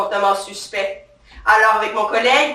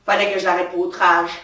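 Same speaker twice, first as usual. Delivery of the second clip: usual speaking voice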